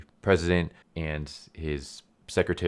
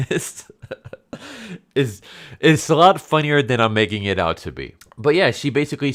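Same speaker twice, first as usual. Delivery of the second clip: laughing; 8 semitones higher, 7 dB louder than usual. Real, not acted. Laughing at the funny scene.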